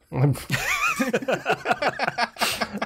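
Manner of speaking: high laugh bursting out; burst of laughter. Real, not acted. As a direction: laugh hard at the pun.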